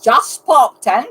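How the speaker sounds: put-on loud, slow Irish-accent parroting; mock Irish accent. Mimicking a friend's Irish accent attempt, loud and drawn out. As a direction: boom it out slowly in mock Irish.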